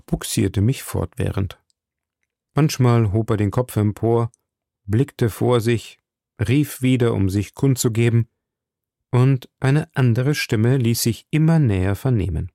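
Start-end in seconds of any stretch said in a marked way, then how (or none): none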